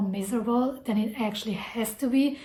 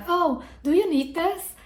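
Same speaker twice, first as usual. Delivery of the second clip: high, rising mom offering; lightly voiced pushy mom; imitating mom; 8 semitones higher than usual. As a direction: mimic a doting mother's question. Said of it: "she'd be like".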